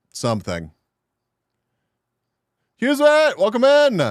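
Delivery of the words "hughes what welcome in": loud, high-pitched welcome; excited greeting. Real, not acted. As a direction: call out in happy surprise. Greeting an arriving viewer.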